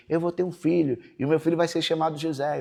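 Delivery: preaching. Retold or imitated. Pastor proclaiming to the church a son named José, preaching voice. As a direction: declare it like a preacher sure of God's promise. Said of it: "eu pregava na igreja".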